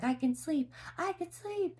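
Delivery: playfully mimicking the daughter's boast, high up; child's voice. Daughter boasting she can sleep, high child voice. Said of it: "she's like".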